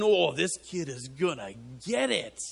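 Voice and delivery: imagined onlooker reaction. Acted. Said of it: "so you can all imagine".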